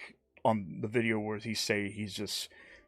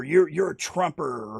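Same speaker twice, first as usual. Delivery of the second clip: mock accuser; 4 semitones higher, 4 dB louder than usual. Mocking political name-calling.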